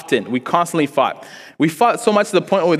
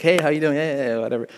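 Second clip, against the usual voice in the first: trying to be nice; 2 semitones higher than usual. Retold or imitated. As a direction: imitate small talk to win someone over. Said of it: "when you try to be nice".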